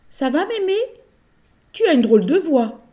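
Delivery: put-on puzzled little girl. La fillette s'inquiète. (voix de fillette intriguée)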